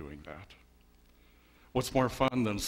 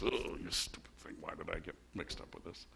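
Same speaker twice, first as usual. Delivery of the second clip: pouting; 3 semitones lower, 6 dB quieter than usual. Retold or imitated. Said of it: "he pouts about this".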